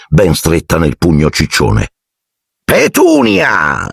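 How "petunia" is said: high, slow, drawn-out exclamation; long high greeting call; Aunt Marge exclaiming. A narrator's reading. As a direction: stretch the name out high. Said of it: "esclamò zia Marge".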